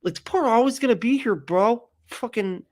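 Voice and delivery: high, whiny mock Jesus complaining; hammed-up aggrieved Jesus. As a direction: complain like a put-upon martyr bro. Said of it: "He's like".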